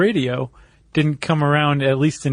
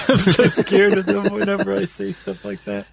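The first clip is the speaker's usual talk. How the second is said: laughing; 6 semitones higher than usual. Real, not acted. Laughs at his own remark.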